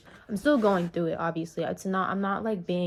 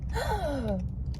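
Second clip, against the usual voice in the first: sharp audible gasp, retold; surprised gasp; 6 semitones higher than usual. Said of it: "And I was like".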